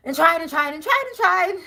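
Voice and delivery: mounting exasperation. Acted. Repeating tried with rising exasperation.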